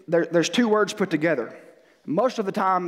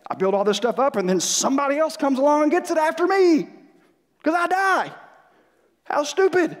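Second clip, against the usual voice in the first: put-on higher, exasperated old king; mock Solomon voice; 9 semitones higher than usual. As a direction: voice Solomon in a lament. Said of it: "My translation".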